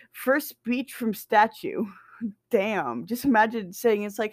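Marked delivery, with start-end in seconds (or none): none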